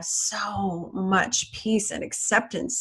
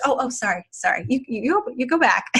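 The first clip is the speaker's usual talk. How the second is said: polite inner roommate; 4 semitones higher, faster than usual. Pretend. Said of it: "my Sally ... is so polite".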